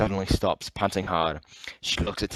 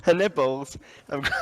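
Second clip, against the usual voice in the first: horrid mock British; 6 semitones higher than usual. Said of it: "That's horrid".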